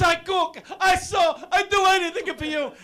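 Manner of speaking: high loud mock-mother wail; mother shouting. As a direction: belt it out like a clingy Italian mother. Mother's pleading retold.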